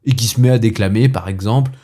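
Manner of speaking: lightly indignant gentleman in a comic monologue; irritated monologue-hater. Acted. Gentleman rants to the audience against monologues, mildly exasperated. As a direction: complain to the audience with theatrical exasperation.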